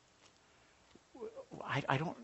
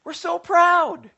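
proud exclamation; 12 semitones higher, 11 dB louder, much slower than usual. Retold or imitated. Proud parents.

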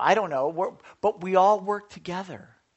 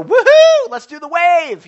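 much higher and louder whoop; cheering woohoo; 12 semitones higher, 19 dB louder, slower than usual. Retold or imitated. Parents cheering a baby's first steps, loud whoop. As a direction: cheer like proud parents in the stands. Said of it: "makes you go".

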